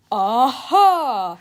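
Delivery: loud gotcha cry; triumphant yell. A narrator's reading. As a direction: yell it as if catching culprits. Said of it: "yelled Mister Krupp".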